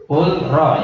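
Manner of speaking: "kul ro" slowly, l held apart from r; original clear l. Shown as a demonstration. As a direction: keep the l of "kul ro" distinct. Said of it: "Aslinya kan dibaca". ("kul ro" pelan, lam dibaca sendiri)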